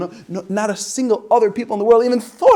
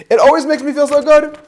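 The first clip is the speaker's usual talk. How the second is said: louder, higher, sing-song storybook read; reading to children; 8 semitones higher, 9 dB louder than usual. Shown as a demonstration. Reading the children's book aloud to listeners, louder and sing-song. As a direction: read it like a bubbly picture book to little kids. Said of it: "Should I read it like this?".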